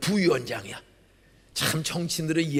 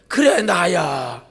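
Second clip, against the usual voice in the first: put-on slow, louder drunk drawl, lightly done; drunk brother; 9 dB louder, much slower than usual. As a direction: drag it out like a drunk older brother. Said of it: "술 취해서". (술 취한 형의 느린 목소리)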